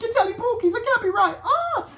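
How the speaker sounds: very high, loud shrieking British lady; high loud British woman calling out the lie. Retold or imitated. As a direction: squeal it like a scandalised Brit. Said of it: "not dropping the British accent".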